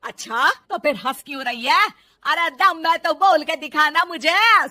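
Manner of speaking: very loud, angry challenge; bullying mother-in-law at full volume; insistent mother-in-law. Acted. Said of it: "सास के जोर देने पर".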